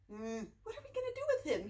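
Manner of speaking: plain reading voice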